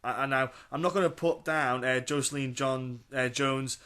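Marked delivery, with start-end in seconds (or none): none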